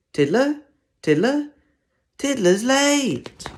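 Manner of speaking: high, loud calling for a missing pupil; teacher calling out. Acted. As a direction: call out, then slowly announce he's late.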